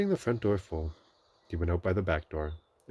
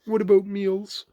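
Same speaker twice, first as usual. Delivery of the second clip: Pooh; 10 dB louder, slower than usual. A narrator's reading. Pooh worried.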